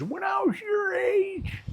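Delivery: high mocking old-timer voice; preachy old-timer voice. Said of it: "one of these".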